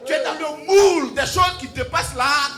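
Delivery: loud, rising preacher's cry over the music; sermon shouted with fire; full-force preaching. Acted. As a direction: proclaim it to the whole hall.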